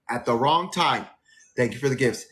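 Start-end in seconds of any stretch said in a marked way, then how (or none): none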